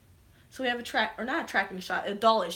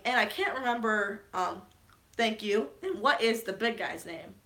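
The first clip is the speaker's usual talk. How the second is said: usual speaking voice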